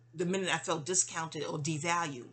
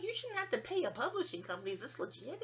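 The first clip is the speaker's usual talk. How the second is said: imitating mom; 6 semitones higher, 5 dB quieter than usual. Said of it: "You was like".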